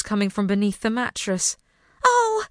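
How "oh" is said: high shocked shriek. A narrator's reading. Rosalind shrieking at Alfred over the melon. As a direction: shriek it in alarm. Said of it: "she shrieked".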